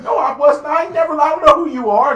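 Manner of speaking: loud, high protest; hammed-up outraged shout; mock shouted denial. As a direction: yell the denial like you're innocent. Acted denial in skit.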